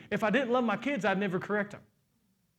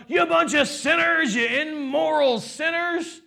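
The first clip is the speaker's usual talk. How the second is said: slow, loud, higher accusing shout; mock condemning shout; 8 semitones higher, 6 dB louder, slower than usual. Paul wrongly scolding the Corinthian church, mock shout. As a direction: hammer them like a harsh preacher.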